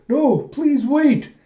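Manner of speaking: high, loud drawn-out cry; desperate plea. Retold or imitated. Someone begs the rampaging fighter to wait, high desperate plea. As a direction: plead loudly, stretching the words.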